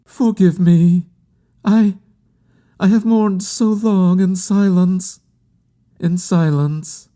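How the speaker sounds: high, halting plea; grieving woman. A narrator's reading. Woman asking forgiveness for long-silent mourning, high and halting. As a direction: plead in a high mourner's voice, breaking off and resuming. Bereaved woman apologizes for her outpouring.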